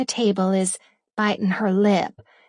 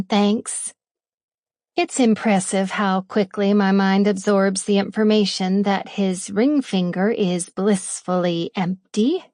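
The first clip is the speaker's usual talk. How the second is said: ordinary delivery, nothing marked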